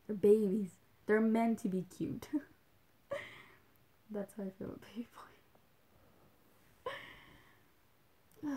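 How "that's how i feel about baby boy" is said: quick, soft whisper; fast giggly whisper. Real, not acted. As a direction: whisper it fast, like a secret aside. Whispering aside.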